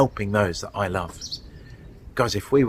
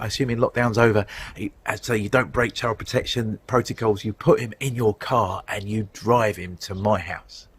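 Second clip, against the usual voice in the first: ordinary talk, nothing marked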